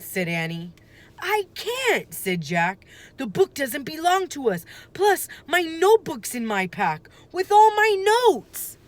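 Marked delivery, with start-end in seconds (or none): 1.2-8.8 high, louder worried protest; insist, getting more frantic; anxious insistence. A narrator's reading. Boy insisting he must fetch his notebook, anxious and high.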